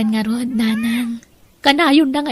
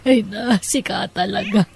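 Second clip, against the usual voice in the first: ordinary delivery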